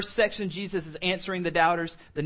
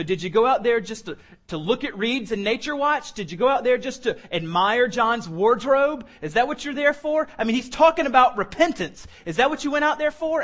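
much higher and louder, incredulous mockery; mounting sarcasm; 6 semitones higher, 5 dB louder than usual. Jesus ridicules the crowd's motives, sarcasm rising higher and louder. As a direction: build the sarcasm until it nearly cracks. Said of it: "throwing some crazy sarcasm".

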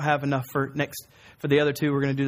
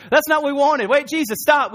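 higher, louder mock protest; 8 semitones higher, 5 dB louder than usual. The man's friends objecting to Jesus, protesting. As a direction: voice an indignant interruption.

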